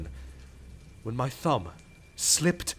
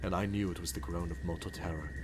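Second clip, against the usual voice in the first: hushed dread; 7 semitones lower, 8 dB quieter than usual. Acted. Recounting the groan of terror.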